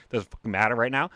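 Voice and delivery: ordinary talk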